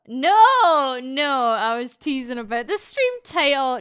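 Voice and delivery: loud, emphatic no; loud denial. Real, not acted. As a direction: shout it down, insisting. Denying drug use loudly.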